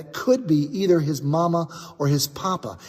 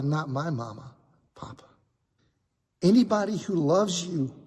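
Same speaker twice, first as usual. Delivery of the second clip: tender; 5 semitones higher than usual. Acted. Daniel tells Aaron anyone who loves you is family, tender.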